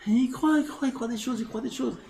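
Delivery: high, repetitive mock lament; mocking incredulity. Teacher on the man who believes things, high mocking tone. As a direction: pitch it up, pitying his illusions.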